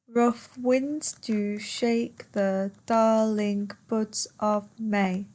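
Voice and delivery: slow, syllable-by-syllable verse reading; per suku kata. Shown as a demonstration. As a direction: count out the line, one beat per syllable. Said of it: "how many syllables are in that line".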